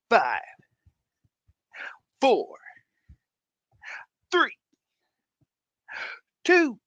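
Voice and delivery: show-opening countdown climbing to a big one; building countdown. Acted. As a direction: build the hype number by number. Dramatic countdown to show start.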